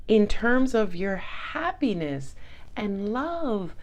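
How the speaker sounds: slow, soothing reader's voice; calming serene voice. Acted. Tarot reader soothing her Scorpio viewers, slow calm voice. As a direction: ease them into calm, slowly. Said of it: "in a very calming and serene voice".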